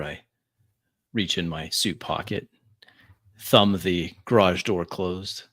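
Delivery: ordinary talk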